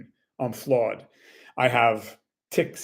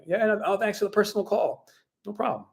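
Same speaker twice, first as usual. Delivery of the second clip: higher-pitched voice of a thankful customer; grateful customer; 9 semitones higher, 3 dB quieter than usual. Acted. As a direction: play the customer pleased by a personal call.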